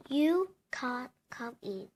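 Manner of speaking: plain reading voice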